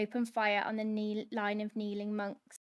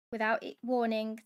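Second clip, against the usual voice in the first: usual speaking voice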